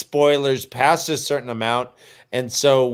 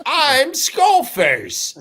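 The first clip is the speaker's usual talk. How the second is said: Skullface voice; 9 semitones higher, much slower than usual. Retold or imitated. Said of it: "He's like".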